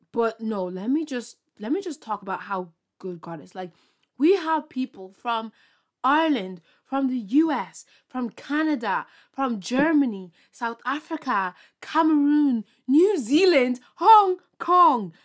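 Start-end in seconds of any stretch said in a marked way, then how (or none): 4.2-15.1 building louder and higher toward Hong Kong; growing excitement. Real, not acted. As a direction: list them with rising thrill. Listing listener countries excitedly.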